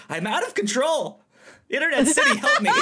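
high, loud pleading wail; mock cry for help. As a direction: beg the audience in mock despair. Mock plea to listeners.

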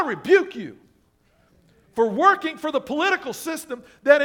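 loud, high preacher's denunciation; acted angry rebuke. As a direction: rail at a sinner from on high. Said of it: "That's what most of us would do".

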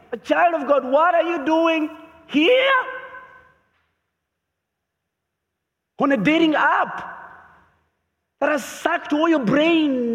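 high, tearful calling-out voice of God; God's voice rising high and crying out, then pressing with each question; pleading voice of God. Acted. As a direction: plead like a father searching for a lost son. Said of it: "And the voice comes again".

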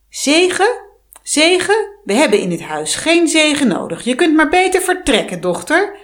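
loud, high outraged hag; indignant witch. Acted. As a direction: recoil at the word and throw her out. Heks ontstemd over moeders zegen. (luide, verontwaardigde heks)

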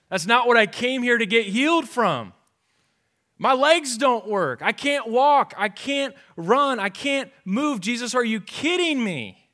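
high, loud, pleading complaint; exasperated protest. Acted. Paralytic complaining loudly to Jesus, exasperated shout.

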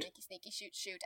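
very soft sneaky singsong; sneaky whisper. Pretend. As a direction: hush it like sneaking up. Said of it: "do your sneaky".

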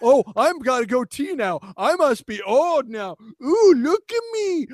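high mocking old man voice; mock him like a pompous old captain; mocking Picard voice. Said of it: "cue ball Baldy".

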